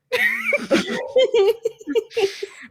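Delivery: long, high-pitched fit of laughter; high cackling laugh; real laughter. Real, not acted. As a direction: crack up helplessly, high. Friends laughing during teasing argument.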